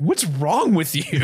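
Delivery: hammed-up, drawn-out indignant question; slow it down and overplay the outrage; Roger Rees. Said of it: "Roger Rees is really going hard".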